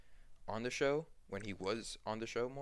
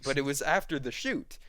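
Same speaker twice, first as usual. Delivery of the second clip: raised, insistent objection; mock protest; 4 semitones higher, 6 dB louder than usual. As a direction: lightly act out arguing back, higher and louder. Acted argument over blame.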